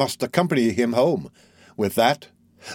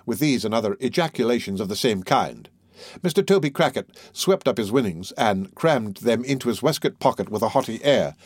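ordinary talk

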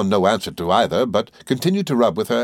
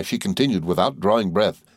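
ordinary delivery, nothing marked

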